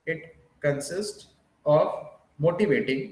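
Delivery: slow, spaced dictation with repeated phrases. Shown as a demonstration. Teacher dictating notes to students, slow and spaced. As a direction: spell it out slowly for note-takers. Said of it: "बहुत धीमे धीमे बोल रहा हूँ".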